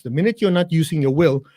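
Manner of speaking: ordinary talk, nothing marked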